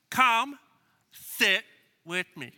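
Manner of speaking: feed it to a crowd to repeat; leading recitation. Shown as a demonstration. Pastor getting the congregation to say it along, measured. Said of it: "Say it with me".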